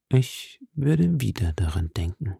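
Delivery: whispered, hollow and dark; dull hollow voice. A narrator's reading. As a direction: breathe it out darkly. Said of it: "mit dumpfer Stimme".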